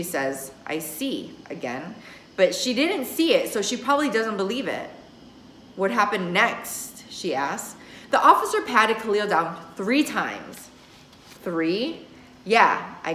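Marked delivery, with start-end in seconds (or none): none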